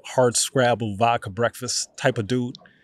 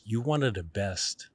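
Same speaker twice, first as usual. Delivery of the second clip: soft, quieter reassurance; gentle talk; 5 dB quieter than usual. Retold or imitated. Teacher taking the upset student aside, softly reassuring. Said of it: "He's like".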